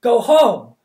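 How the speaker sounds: loud high no; commanding shout. Acted. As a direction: command the dog at full voice. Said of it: "Charmaine commanded".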